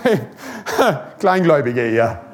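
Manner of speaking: low, laughing mock rebuke of Peter; preacher laughing as Jesus scolds wet Peter. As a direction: tease Peter with a chuckle in Jesus' voice. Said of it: "und Jesus sagt".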